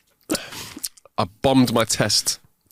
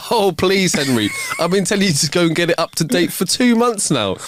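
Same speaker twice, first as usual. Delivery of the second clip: lightly acted nagging wife, higher pitch; exasperated nagging; 6 semitones higher than usual. Wife tells on her husband to the traffic cop, exasperated nagging. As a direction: chide him with mild exasperation.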